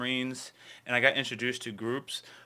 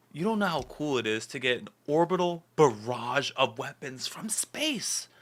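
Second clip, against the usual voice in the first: ordinary delivery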